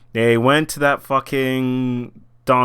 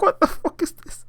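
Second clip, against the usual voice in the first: whispered high disbelief; stunned whisper; 13 semitones higher, 4 dB quieter than usual. Retold or imitated. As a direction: breathe it out in shock. Whispered reaction to Netflix clip.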